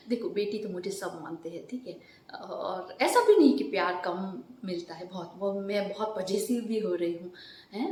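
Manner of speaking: normal talking voice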